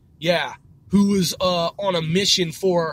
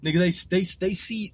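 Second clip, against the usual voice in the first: usual speaking voice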